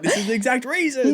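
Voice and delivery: lightly put-on voice of scoffing bullies; mocking commenters. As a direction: imitate gleeful online hecklers. Mimics the bullies' online jeer.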